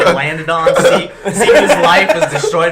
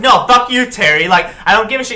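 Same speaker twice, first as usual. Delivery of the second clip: higher and louder, snapping; mock angry outburst; 7 semitones higher, 6 dB louder than usual. Retelling how he told Terry off, mock angry. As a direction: tell him off, half joking.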